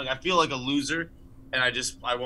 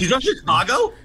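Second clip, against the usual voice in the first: surprised; 10 semitones higher, 8 dB louder than usual. Real, not acted. Surprised at a fun fact.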